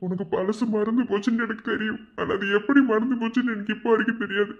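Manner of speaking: high, strained lament with pauses; pained, pleading inner monologue; anguished confession. Acted. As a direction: pour out what you cannot tell her. Anguished unspoken confession to his beloved.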